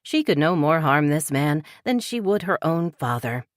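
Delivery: ordinary delivery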